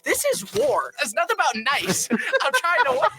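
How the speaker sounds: high-pitched mock outrage breaking into a laugh; heated retort. Player defending ruthless play to family, mock indignant. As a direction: protest like a hammed-up competitive gamer.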